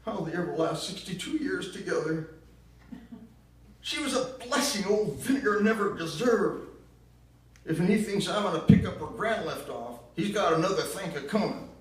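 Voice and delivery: put-on high, loud bitter accusation in a southern accent; bitter southern mother. Ma blaming Gramps for Gran's death, loud southern bitterness. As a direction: spit the blame out in a shrill Southern voice. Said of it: "that nasty tune of hers".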